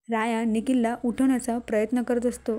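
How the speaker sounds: plain reading voice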